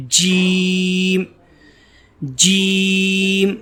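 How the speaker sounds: جیم very slow, with a hard ج; hard jeem. Shown as a demonstration. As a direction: give جیم a firm, strong start. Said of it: "سختی کے ساتھ اس کو پڑھیں گے". (سختی کے ساتھ جیم)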